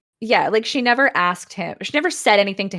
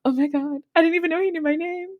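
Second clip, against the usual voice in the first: hammed-up smitten squeal, kept small; mock swooning; 9 semitones higher, faster than usual. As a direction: gush it like a starstruck admirer. Smitten heroine voiced in retelling.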